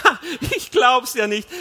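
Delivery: loud, high laughing disbelief; bursting out laughing. A narrator's reading. Wife laughs at husband's childhood promise, loud laughing disbelief. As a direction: burst out laughing as you speak. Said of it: "Dann lachte sie los".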